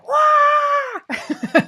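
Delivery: a loud, high whooping cry of celebration; over-the-top crowd cheer instead of a golf clap; mock cheer. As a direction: let out a big silly cheering wah. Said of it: "Or just, like".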